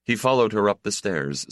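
usual speaking voice

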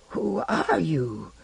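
small husky voice, slow and soft; timid little wizard, husky. Acted. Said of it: "in his husky little voice".

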